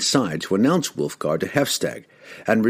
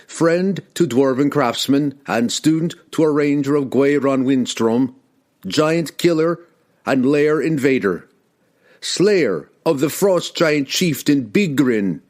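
proud proclamation; 6 semitones higher, 3 dB louder than usual. Acted. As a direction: ring out each title proudly. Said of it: "he proclaimed proudly".

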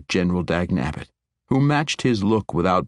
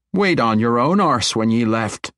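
edgy; 5 semitones higher, 4 dB louder than usual. Acted. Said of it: "an unmistakable edge in her voice".

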